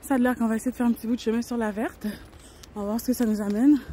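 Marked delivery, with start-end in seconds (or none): none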